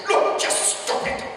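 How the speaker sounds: slow, breath-heavy royal telling-off; hushed scolding queen. Acted. Queen to a giggling visitor beside her, hushed scolding.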